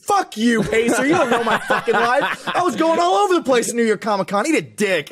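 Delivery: mock-outraged rant, high and laughing; indignant retort to a nagging app, voice pushed high; super upset. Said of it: "I became super upset at it".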